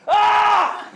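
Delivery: shrill, very high loud scream; sudden piercing high yell; kid's scream. Retold or imitated. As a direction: shriek right in his brother's face. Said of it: "he screamed in his face".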